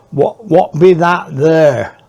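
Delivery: slow, loud Cornish drawl pressing the question; broad Cornish kid asking again, slowly; Cornish accent. Retold or imitated. Boy repeats his question to strangers, slow Cornish accent. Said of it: "in a sort of Cornish accent".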